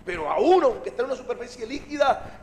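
ordinary talk, nothing marked

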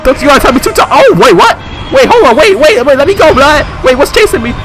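high, loud, frantic yelling; real panic. Real, not acted. Player chased by a monster, high panicked shouting. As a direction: yell it high as you flee. Player fleeing something chasing them.

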